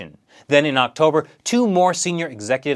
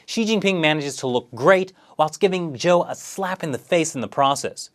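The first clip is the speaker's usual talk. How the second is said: ordinary talk, nothing marked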